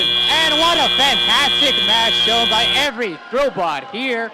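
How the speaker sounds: loud slow triumphant call; dueling hype announcers. Real, not acted. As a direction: cap the match with a roar. Match ending cheer.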